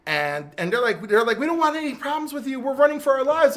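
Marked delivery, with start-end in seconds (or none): 1.4-3.6 high, hurried mock pleading; pitched-up, rushed retold panic; retold frantic plea. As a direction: imitate people begging while on the run. Retold plea of fleeing speedsters.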